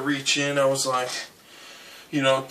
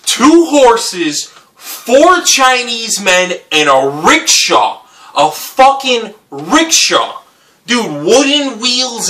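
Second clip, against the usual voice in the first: incredulous build; 8 semitones higher, 5 dB louder, much slower than usual. Real, not acted. Disbelief at what he sees.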